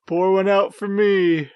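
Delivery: mock toast voice. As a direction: toast it like a doomed party guy. Acting a doomed character's toast.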